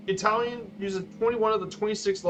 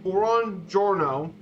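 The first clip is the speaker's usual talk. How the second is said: "buongiorno" very slow and careful; 3 dB louder, much slower than usual. Shown as a demonstration. Presenter teaching the class the formal greeting. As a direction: draw "buongiorno" out. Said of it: "a formal good morning is".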